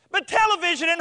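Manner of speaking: high, loud fretting; mock panic. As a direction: burst out high and alarmed, ready to be cut off. Mock fretting, then hushed.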